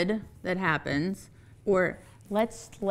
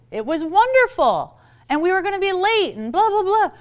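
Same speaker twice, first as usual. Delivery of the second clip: much higher and quickening into a rush; a hammed-up over-eager caller; gushing praise; 9 semitones higher than usual. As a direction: pile on the enthusiasm to the supervisor. Gushing to the supervisor.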